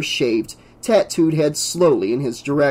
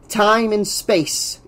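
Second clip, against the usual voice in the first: higher, slow lecturing voice; reedy assistant; 7 semitones higher, much slower than usual. A narrator's reading. Assistant lectures the crew on time, reedy and slow. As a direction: pontificate slowly in a reedy pitch. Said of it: "the reedy voice of Bardot's assistant".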